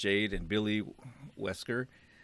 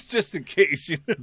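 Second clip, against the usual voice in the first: said through a laugh, higher and louder; 8 semitones higher, 7 dB louder than usual. Real, not acted. Cracking up at a friend's backup plan, laughing. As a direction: laugh while getting the words out.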